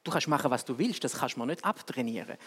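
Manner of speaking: ordinary delivery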